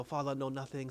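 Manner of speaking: quiet imitation of broken-English accent; put-on broken English. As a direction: lightly mimic immigrant English, playing innocent. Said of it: "you don't really talk like that".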